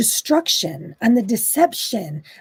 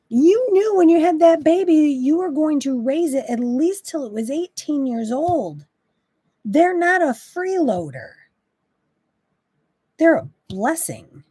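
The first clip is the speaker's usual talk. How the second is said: fired up; 6 semitones higher than usual. Real, not acted. Speaker scolding parents who call kids freeloaders, fired up. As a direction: push it, a little riled. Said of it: "that one gets me a little fired up".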